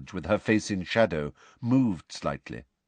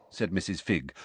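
ordinary delivery, nothing marked

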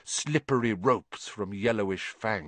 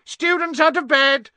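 high-pitched full-force yell; bellowing; 8 dB louder than usual. Acted. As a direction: raise the alarm to the whole castle. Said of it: "Peeves bellowed".

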